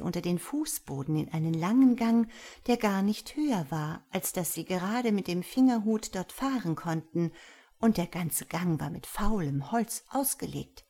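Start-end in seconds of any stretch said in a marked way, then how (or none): none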